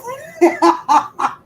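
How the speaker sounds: high burst of laughter; laughing. Real, not acted. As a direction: laugh out loud at yourself. Laughs at own mix-up.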